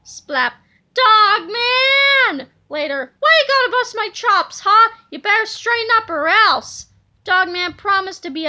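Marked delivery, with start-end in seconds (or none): 0.9-2.5 loud, high angry shout. Acted. Chief shouts at Dogman. 3.2-6.9 louder, higher gruff scolding. Acted. Chief scolds Dogman.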